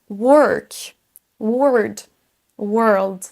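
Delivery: work, word, world with a central er vowel; careful model of work, word, world; correct English vowel. Shown as a demonstration. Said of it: "une voyelle entre un son E et un son E".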